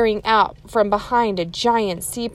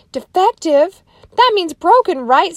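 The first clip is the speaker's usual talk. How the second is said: much higher and louder, startled question; alarmed Annie; 10 semitones higher, 8 dB louder than usual. Acted. Teacher voicing Annie, alarmed the sub is broken. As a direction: squeak it out in alarm.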